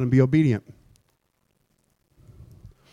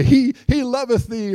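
King James phrase; 7 semitones higher than usual. Retold or imitated. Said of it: "you may hear me say".

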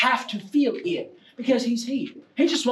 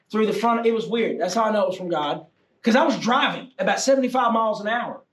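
ordinary talk

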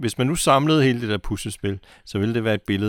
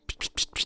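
whispered spray-bottle psst noises; spray bottle sound; 8 dB quieter than usual. Retold or imitated. Assistant spritzing water on the child actor's face, psst spray noises. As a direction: puff out quick psst bursts like a spray bottle. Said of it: "med sådan en".

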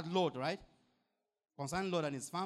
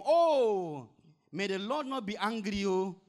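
loud, high cry of Oh begging mercy; Abraham begging the Lord not to be angry, with a loud, high cry of Oh; pleading Abraham; 4 semitones higher, 8 dB louder, slower than usual. Acted.

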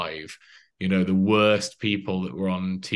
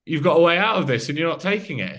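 much higher and louder, fast and insistent; protester's reply; 8 semitones higher, 6 dB louder, faster than usual. Retold or imitated. Protester defending his death wish, in a high, fast, loud voice. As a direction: pitch it up as the ranting protester, quick and loud. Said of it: "and he went".

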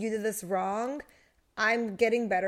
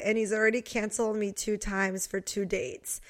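ordinary delivery

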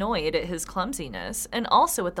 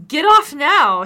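loud, high, short order; brusque horseman's command; curt command; 6 semitones higher, 12 dB louder, slower than usual. Acted. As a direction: bark it out loud. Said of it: "he said shortly".

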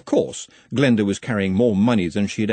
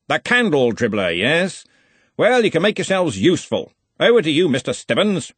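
higher, bossy wizard dialogue; brisk and commanding, pitched up; lightly voiced senior wizard, brisk; 6 semitones higher, 4 dB louder than usual. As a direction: hand out orders like a head of college.

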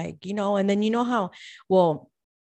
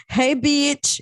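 mock translation; 9 semitones higher, 6 dB louder than usual. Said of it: "That means".

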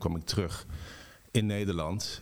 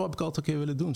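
eager; 8 semitones higher, much faster than usual. Retold or imitated. Eager reply to the neighbour.

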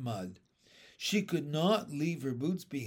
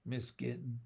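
ordinary delivery

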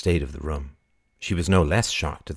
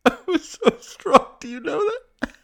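very high wail sinking into sobs; tearful; 4 dB louder than usual. Acted. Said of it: "she said, suddenly tearful".

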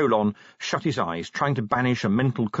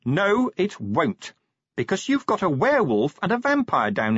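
higher, exasperated woman sergeant; impatient Angua; 9 semitones higher than usual. Acted. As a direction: lay down the law at the end of your tether.

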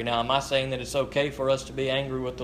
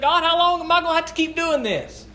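higher, louder, fed-up cry to God; exasperated complaint; 12 semitones higher, 3 dB louder than usual. Retold or imitated. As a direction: vent like a worn-out man pushing his bike uphill. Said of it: "he was kind of angry and he was kind of short".

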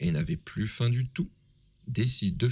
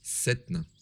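plain reading voice

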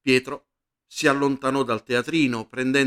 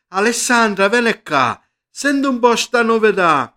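entusiasta; 6 semitones higher, 6 dB louder than usual. Acted. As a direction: voice rising with glad excitement. Ambrogio entusiasta chiama Alessandra. (voce esultante che chiama la moglie)

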